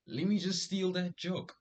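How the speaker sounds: high, loud rush of an idea; eager inner voice. Retold or imitated. Thinking about stealing a friend's joke, eager and loud. Retelling his own eager thought.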